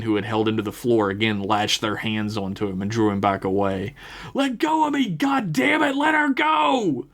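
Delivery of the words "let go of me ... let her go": high, desperate yelling; full-throated shouted demand; furious scream. A narrator's reading. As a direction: scream to free her, stretching the final words. Captive Johnny screams at his captors.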